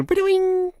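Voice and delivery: high springy ba-doing; a silly high boing; cartoon boing. Mimics the antenna bouncing.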